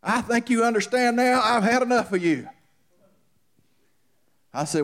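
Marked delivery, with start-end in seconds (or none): 0.0-2.5 high, loud retelling of the man's words; quoting the angry man. Retold or imitated. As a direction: voice the fed-up man, still preaching. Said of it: "he said".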